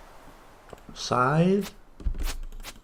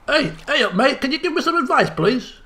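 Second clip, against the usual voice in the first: light put-on northern English accent, pitched higher; Screamfinger's accent; 8 semitones higher than usual. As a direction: hail a villager in a mock northern English accent. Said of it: "Screamfinger's official accent".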